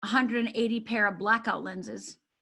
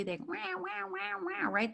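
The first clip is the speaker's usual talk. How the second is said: high, soft imitated baby crying; mock baby wail; 9 semitones higher, 6 dB quieter, slower than usual. Coach mimicking a hungry baby for the audience, soft mock wail. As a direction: wail like a hungry infant, lightly.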